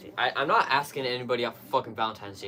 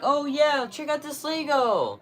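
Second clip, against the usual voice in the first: mom's voice, pitched way up and louder; 13 semitones higher, 4 dB louder than usual. Retold or imitated. Mom telling her son about a Lego set, high imitated voice. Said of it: "my mom was like".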